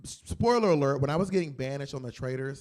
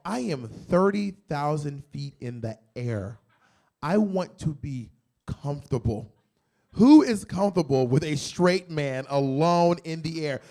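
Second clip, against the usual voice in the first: ordinary delivery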